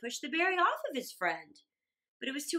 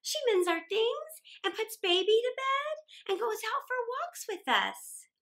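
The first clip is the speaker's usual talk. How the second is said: put-on high, bright child's voice; little girl; 9 semitones higher than usual. A narrator's reading. Mary tells her father about Aunt Jenny, high and eager. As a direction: chatter on like a small girl.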